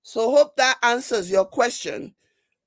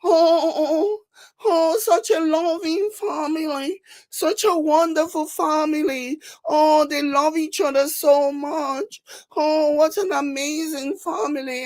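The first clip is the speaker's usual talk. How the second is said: higher-pitched mocking coo; sugary fake admiration, pitched up; gushing royal fans; 5 semitones higher than usual. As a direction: gush mockingly like an adoring fan. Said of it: "the response from the people who obviously like".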